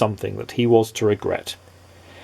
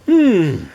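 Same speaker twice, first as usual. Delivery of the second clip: pleased hmm; 9 semitones higher, 6 dB louder than usual. A narrator's reading. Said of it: "smiled Mister Mischief".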